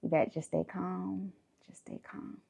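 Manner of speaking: soft warning fading to a whisper; calm her down quietly, last words breathed; hushed warning. Retold or imitated. Friend in the front seat hushing her, whispered. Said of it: "in the front seat said".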